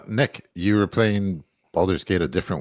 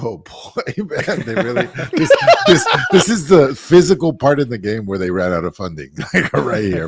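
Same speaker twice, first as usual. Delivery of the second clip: laughing, high and loud; 5 semitones higher, 5 dB louder than usual. Retold or imitated. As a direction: laugh through the remark. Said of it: "I was like".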